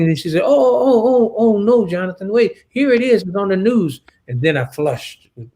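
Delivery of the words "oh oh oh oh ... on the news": higher and louder, repeated startled oh's; suddenly spotting the news report; imitating a friend's alarmed surprise; shocked discovery.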